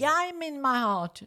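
insist, voice up high; retold insistence. Protesting to Fabio that she is in her heart, high and loud.

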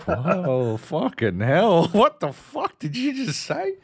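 slow, rising disbelief up high; stunned, stretched-out what the fuck; shocked whoa. Retold or imitated. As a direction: react to hearing a slur. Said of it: "And you're like".

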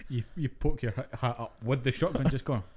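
normal talking voice

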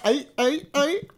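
high playful frog imitation; mock frog croak. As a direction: parrot the frogs with a giggle. Co-host mimics frogs, laughing.

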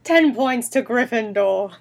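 slow, drawn-out fake British pronouncement; stately mock British accent. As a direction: announce it slowly in hammed-up British. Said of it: "There's your Harry Potter reference".

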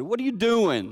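loud, high challenge; stern shout. Retold or imitated. Misspoken retelling of grandpa confronting dad, stern shout. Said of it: "my brother said".

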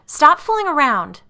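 high, loud, desperate shout; panicked scream. A narrator's reading. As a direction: beg them to stop, screaming. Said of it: "Allison screamed".

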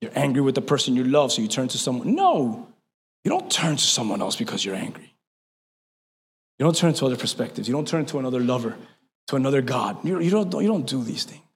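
low, soft pastoral aside; quiet intimate. Acted. Pastor speaking softly to the church.

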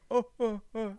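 high little panicked ohs; imitated panic. Shocked lady sat in her car, imitated panicky ohs. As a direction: mimic someone frozen in panic. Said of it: "in panic 'cause she just sat in the car".

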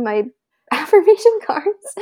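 high, laugh-filled words; laughing retelling. Retold or imitated. Asking husband to find the cards, laughing. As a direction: let laughter lift the words up.

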